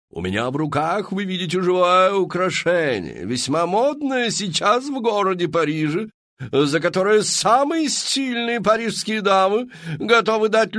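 high, loud, theatrical madame pitching to the crowd; showy saleswoman. Acted. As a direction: sell it like a grand Parisian hostess. Мадам Фру-Фру обращается к толпе. (высокий громкий голос мадам-зазывалы)

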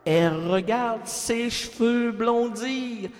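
slow, drawn-out ballad narration over music; dramatic chanson recital. Acted. Mock tragic ballad about lonely Brigitte, slow and sung-spoken. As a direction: croon it like a sad French chanson.

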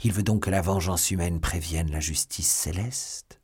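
usual speaking voice

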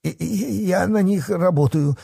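high, stammering confession; trembling fear. A narrator's reading. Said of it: "трясясь всем телом".

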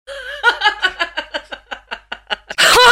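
soft high trailing giggle. Acted. Galina giggles at the surname Khrenov, soft high giggle.

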